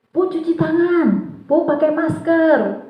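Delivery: put-on high, drawn-out child's voice; anak menjawab guru. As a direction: imitate pupils piping up to teacher, slowly. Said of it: "anak-anak ada yang menjawab". (suara anak bernada tinggi)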